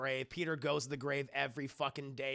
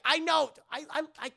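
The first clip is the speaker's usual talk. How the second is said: stammering; 11 semitones higher, 7 dB louder than usual. Retold or imitated. Peter stammering a dodge.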